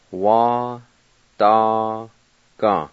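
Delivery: "Wa, da, Ga" split into separate syllables with pauses. Shown as a demonstration. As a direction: spell out "Wa, da, Ga" one syllable at a time. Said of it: "I'll go back and pronounce them".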